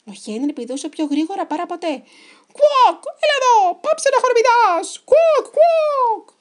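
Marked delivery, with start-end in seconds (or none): 2.5-6.3 very high, very loud cartoon hen shriek; squawking chicken pirate. A narrator's reading. Chicken pirate chasing hopping Henry, squawking. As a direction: squawk angrily in a piercing chicken voice.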